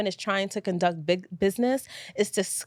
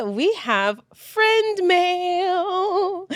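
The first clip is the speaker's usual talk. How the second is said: drawn-out announcement; 8 semitones higher, much slower than usual. Acted. Announcing the new segment name.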